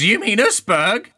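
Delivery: put-on high, loud correcting kid; questioning child. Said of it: "the other child goes".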